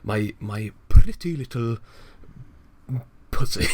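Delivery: lightly hammed posh British accent; old posh British. Mocking how people spoke before, posh British accent. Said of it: "everybody was being like".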